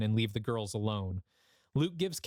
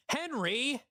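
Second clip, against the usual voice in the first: high sharp call of his name; yell; 12 semitones higher than usual. A narrator's reading. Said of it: "Beth and Luke yell in unison".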